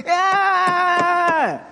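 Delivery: put-on sudden high baby shout; baby's yell. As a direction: blurt it out like a baby cutting in. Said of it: "the baby ... just goes".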